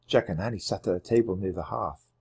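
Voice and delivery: ordinary delivery, nothing marked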